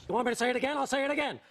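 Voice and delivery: ordinary delivery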